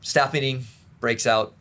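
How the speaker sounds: normal talking voice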